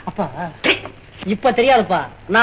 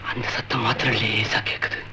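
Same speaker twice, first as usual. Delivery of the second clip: low, hushed suspense with long pauses; spooky storytelling; 10 semitones lower, 9 dB quieter than usual. Acted. Son telling father a horror story, spooky and hushed. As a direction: creep him out, softly and haltingly.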